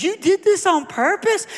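high, loud tearful squeal of disbelief; mock crying. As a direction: imitate a waitress bursting into tears. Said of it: "She just starts crying".

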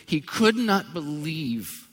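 slow stressed disbelief; slow, emphatic. Acted. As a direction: lean on every word slowly.

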